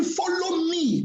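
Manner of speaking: "Follow me" high and drawn out; stress on ME. Retold or imitated. Preacher quotes Jesus calling disciples, stress on "me". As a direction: hold out "me" as a slow, high call. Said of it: "The emphasis no".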